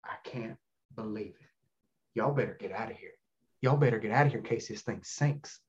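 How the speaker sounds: low, hushed Southern warning; Southern accent. Retold or imitated. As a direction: imitate a stunned Southern man, very soft and low. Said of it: "I do the Southern accent".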